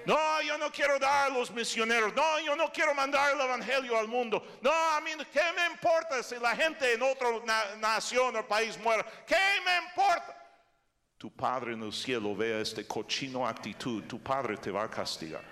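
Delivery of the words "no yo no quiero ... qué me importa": high, loud whiny refusal. Acted. Preacher mimics a selfish believer refusing missions, high loud whine. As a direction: play the selfish churchgoer who won't give.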